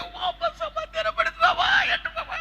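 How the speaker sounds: high, thin mock-frightened squeal; hammed-up frightened whimper; comic panic. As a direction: play a scared woman alone, far above your voice. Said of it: "బిక్కు బిక్కు బిక్కు అంటూ".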